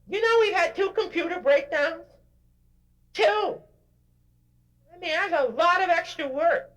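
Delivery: high, whiny mock complainer voice; complaining employee. Speaker mocks an employee complaining about his job, in a high whiny mock voice. Mocking whining complainer voice continues.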